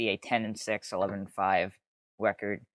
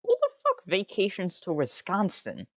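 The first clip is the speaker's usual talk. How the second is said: much higher, disbelieving outburst; incredulous; 9 semitones higher than usual. Real, not acted. Streamer still baffled by the Wisconsin vacation, high and exasperated.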